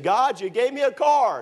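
high, loud, exasperated cry of God, you gave me a car; frustrated complaint to God. Retold or imitated. Broke student complaining to God after the motor blew. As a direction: throw the complaint up to heaven. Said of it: "I said".

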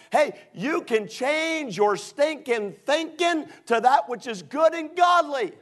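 loud, high, ringing sermon delivery; full-force preaching. Acted. Preacher urging the congregation to change their thinking, full-force preaching.

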